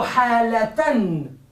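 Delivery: "halatan" stretched slowly, clear t in -tan; slow demonstration of "halatan" with a held final n; pronounced t ending. Shown as a demonstration. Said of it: "hay que pronunciarlo como ta".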